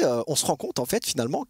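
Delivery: ordinary talk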